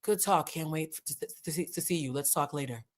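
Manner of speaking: soft, unbothered imitation of Stacey; breezy, lightly voiced calm; mild soothing Stacey voice; imitating calm Stacey. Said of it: "whenever I wanna imitate Stacey".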